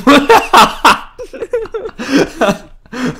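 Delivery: loud laugh. Real, not acted. Laughing at game moment.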